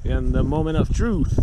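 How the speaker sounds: loud, eager call at the catch; excited shout. Real, not acted. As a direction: shout it out as the fish comes up. Big catch coming up the hole.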